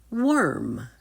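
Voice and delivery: ordinary delivery, nothing marked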